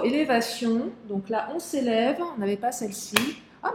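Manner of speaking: ordinary delivery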